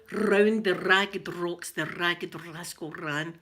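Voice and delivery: slow "Round the ragged rocks" with every R trilled; rolled Rs. Shown as a demonstration. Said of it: "we like to roll with R's".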